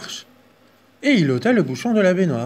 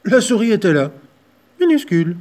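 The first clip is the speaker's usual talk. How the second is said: louder, higher surprise; spring the mouse on them; surprised reveal; 7 semitones higher, 8 dB louder than usual. A narrator's reading.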